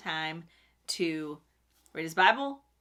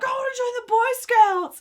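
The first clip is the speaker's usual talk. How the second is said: put-on high, loud eager child; excited kid voice; 8 dB louder than usual. Kid's excited request retold.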